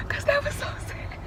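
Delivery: high tearful sob through the words; crying. Real, not acted. Recounting the sad story to mom, crying. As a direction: let the sob break in. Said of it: "tearing up".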